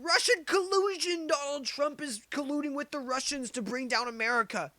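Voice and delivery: high, loud mock panic about Russia; mocking shouted alarm. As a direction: ham up the hysterical pundit. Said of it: "spent the last four years shouting".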